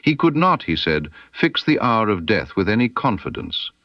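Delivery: usual speaking voice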